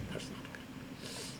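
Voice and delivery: slow, whispered repeat; whispered correction. Real, not acted. Teacher whispering the correction back to students, slowly. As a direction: whisper it slowly, letting it sink in.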